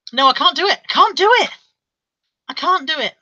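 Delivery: normal talking voice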